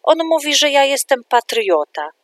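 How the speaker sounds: high, loud indignant complaint; boy still shouting his grievance at the teacher; child shouting. A narrator's reading. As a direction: keep yelling it up high and loud. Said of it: "krzyknął nagle Julek".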